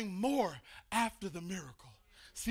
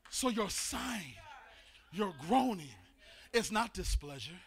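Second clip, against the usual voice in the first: normal talking voice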